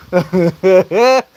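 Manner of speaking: short burst of loud real laughter. Real, not acted. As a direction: laugh out loud at your own joke. Laughs at own Tongan joke.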